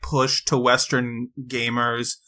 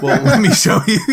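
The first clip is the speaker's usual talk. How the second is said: high, louder taunt, laughing; the company mockingly answering fans who hated the last game, high and laughing; mock taunting Capcom; 7 semitones higher, 4 dB louder than usual. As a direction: tease the fans with a gleeful threat.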